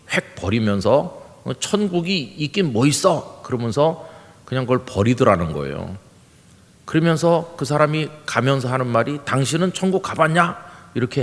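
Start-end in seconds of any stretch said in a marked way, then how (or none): 1.4-3.4 higher-pitched mock scoff; retold scoffing. Passer-by scornfully tosses away tract. 9.2-10.6 high-pitched mock retort; retold scornful challenge. Passer-by's scornful retort while leaving.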